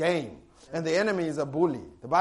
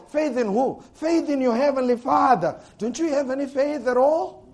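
louder, higher forceful preaching; preacher voicing Jesus's rebuke with force; intense preaching; 6 semitones higher, 4 dB louder than usual. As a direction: press the rebuke on them.

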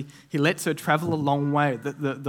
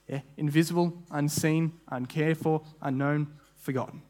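normal talking voice

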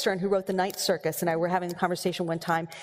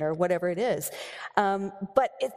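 ordinary talk, nothing marked

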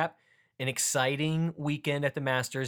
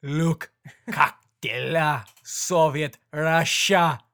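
slow, loud, higher barking fake Russian; angry mock Russian; 3 semitones higher, 7 dB louder, much slower than usual. Host hamming Russian again after cohost laughs, loud angry mock accent. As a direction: shout it like an angry cartoon Soviet. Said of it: "much angrier than the other languages".

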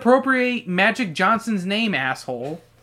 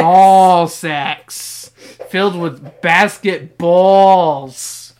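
booming, hugely drawn-out words; hammed-up taunt; 10 dB louder, much slower than usual. Reader teasing a co-host who laughs at ball sacks, hammed-up and booming. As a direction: stretch it out loud and slow for a laugh.

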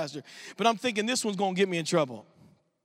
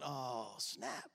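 under-the-breath "oh, snap"; hushed, startled whisper; whispered dismay; 11 semitones lower, 15 dB quieter than usual. Retold or imitated. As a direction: breathe it out like you just got caught. Shock at seeing Andrew among lepers.

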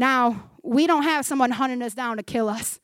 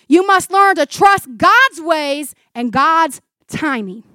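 slow, loud, higher declaration; emphatic preaching; 4 semitones higher, 9 dB louder, slower than usual. Real, not acted. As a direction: space out each phrase with force. Preacher declares key rule.

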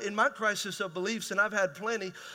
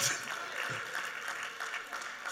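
breathless laugh; 9 dB quieter than usual. Real, not acted. Laughs at own joke.

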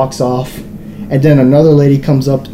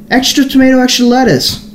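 imitating a condescending customer, much higher; mock a pushy know-it-all in a raised pitch; talking down; 11 semitones higher than usual. Beanie kid butts into his friend's order, talking down. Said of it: "Like, talking down".

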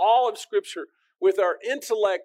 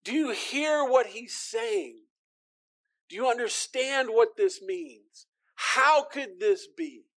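higher, slower, wondering voice; amazed listeners; 5 semitones higher, slower than usual. Retold or imitated. First listeners turn to each other, higher and slowly amazed. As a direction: marvel aloud like a stunned crowd. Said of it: "looking to one another and going".

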